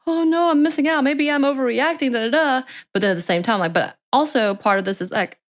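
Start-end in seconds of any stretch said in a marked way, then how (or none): 0.1-2.7 mock panic. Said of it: "And, and I'm like".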